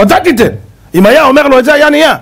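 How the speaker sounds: high-pitched emphatic urging; urgent appeal. Acted. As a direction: insist on the missed reply. Preacher urges what Moses should say.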